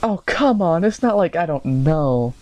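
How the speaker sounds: high, drawn-out teasing voice; mock girlfriend teasing. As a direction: tease him slowly in a put-on voice. Girlfriend teasing embarrassed boyfriend.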